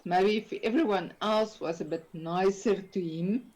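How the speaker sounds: ordinary talk, nothing marked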